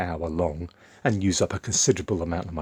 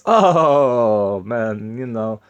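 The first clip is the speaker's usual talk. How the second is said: higher mock Romanian accent, taken aback; 4 semitones higher, 7 dB louder, much slower than usual. Romanian coworker stung by the joke, in a Romanian accent. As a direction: imitate the Romanian guy's accent, caught off guard. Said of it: "I'll do it in his accent".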